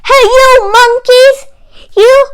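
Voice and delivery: high, loud shout at the monkeys; angry shout. Acted. Peddler shouting up at the thieving monkeys, loud and high. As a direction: yell up into the tree, scolding. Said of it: "he said, shaking his finger".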